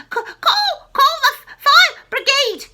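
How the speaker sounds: high, loud stammering yell; loud, stuttering panic; panicked shout. A narrator's reading. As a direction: scream for the fire brigade. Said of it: "she shouted suddenly".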